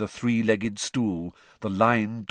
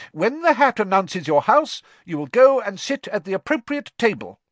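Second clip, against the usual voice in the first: loud, high-pitched, commanding teacher; teacher addressing pupils; 11 semitones higher, 6 dB louder than usual. A narrator's reading. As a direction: project instructions to a hall of new pupils. Teacher continues instructing first years.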